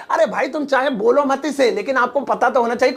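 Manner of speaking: usual speaking voice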